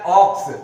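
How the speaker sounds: ऑक्स said alone, opening on the vowel ऑ; pronunciation demo. Shown as a demonstration. Teacher shows students how ऑक्स sounds, pronunciation demo. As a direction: say ऑक्स as a model, vowel first. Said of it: "Hindi का उच्चारण देखना है".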